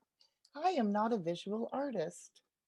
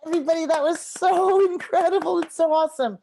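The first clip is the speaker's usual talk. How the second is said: higher, louder, fast gushing with a laugh; excited praise; 8 semitones higher, 10 dB louder than usual. Real, not acted. Teacher praises the children's drawings, excited and louder.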